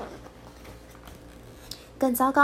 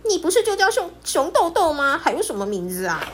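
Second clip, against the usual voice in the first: curious Pipi; 6 semitones higher, 4 dB louder than usual. Acted. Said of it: "皮皮的好奇心马上就来了".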